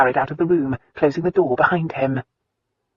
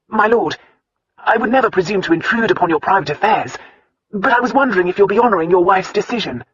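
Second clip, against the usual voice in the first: put-on lightly raised, earnest young butler; a respectful servant, a touch higher; 5 semitones higher, 4 dB louder than usual. A narrator's reading. Butler's dialogue voiced higher.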